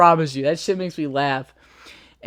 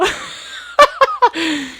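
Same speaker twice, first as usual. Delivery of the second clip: shrieking laugh. Real, not acted. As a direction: howl with laughter. Laughing at own story.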